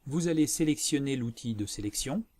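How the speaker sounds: ordinary delivery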